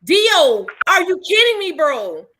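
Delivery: loud, exasperated call-out; outraged. Real, not acted. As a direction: yell at him in disbelief. Outraged at the news.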